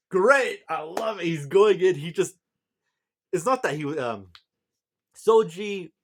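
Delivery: high, loud fan outburst; very excited. Real, not acted. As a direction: burst out gushing, too thrilled to finish the thought. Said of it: "just very excited this chapter".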